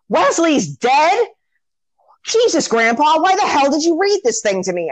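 put-on high-pitched, indignant child voice; Fred Savage. Storyteller as boy interrupting his grandpa's story, high indignant whine. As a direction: protest like a shocked kid, pitched well up. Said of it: "Cut to Fred Savage, who then says".

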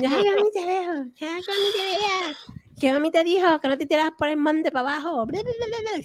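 taunting brother. Retold or imitated. Brother taunting sister about mom, high sing-song tease. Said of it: "mi hermano me decía".

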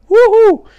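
high, loud cheer; ecstatic whoop. Retold or imitated. Donnie celebrating after sex, loud whoop. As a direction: whoop it out at the top of your voice.

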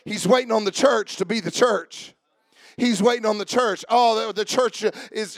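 ordinary talk

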